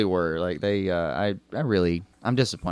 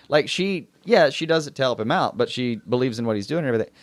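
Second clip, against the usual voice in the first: ordinary talk, nothing marked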